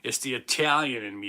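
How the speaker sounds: normal talking voice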